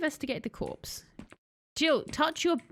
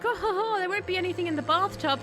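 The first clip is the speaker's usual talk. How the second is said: a hint of sarcastic smugness, lightly higher; lightly voiced mocking self-assurance; mock smug; 6 semitones higher than usual. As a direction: tease the bathtub with fake confidence. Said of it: "I was being like".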